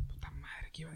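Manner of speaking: whispered to himself; whispering. Real, not acted. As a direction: drop to a whisper. Forgets what he was saying.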